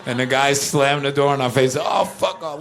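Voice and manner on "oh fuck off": high, quick mock snub; doorman's rude dismissal. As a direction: imitate a doorman brushing them off. Doorman rejects them again, retold.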